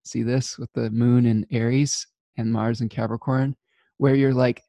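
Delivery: normal talking voice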